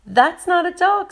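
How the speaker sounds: high, loud, joyful correction; laughing through tears, lightly acted. A narrator's reading. Tracy defends the dog through happy tears, elated. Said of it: "she's laughing through her tears".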